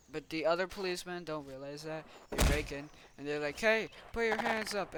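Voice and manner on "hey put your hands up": raised, louder mock order; pretend police command. Policemen breaking in on the gang, mock command. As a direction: call it out like playing cops.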